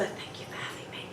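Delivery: hushed whisper at a play date pickup. Retold or imitated. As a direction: whisper the words for the child to copy. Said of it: "for years I would whisper".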